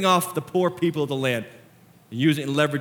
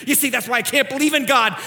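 critic's complaint; 8 semitones higher, 4 dB louder than usual. Acted. Critics of Christians quoted, contrasted.

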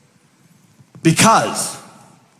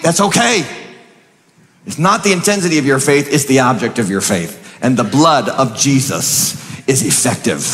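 ordinary talk

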